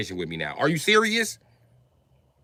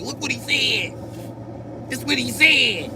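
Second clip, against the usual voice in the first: high put-on voice of a snitch; mocking squeaky voice; 12 semitones higher than usual. Tattling to the Grim Reaper in a squeaky voice.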